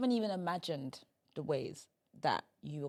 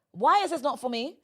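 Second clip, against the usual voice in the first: higher, louder indignant question; demanding; 5 semitones higher, 7 dB louder than usual. Acted. Friend urging guest not to be chilling, demanding and loud. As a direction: challenge it, refuse to sit back. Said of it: "you have to be like".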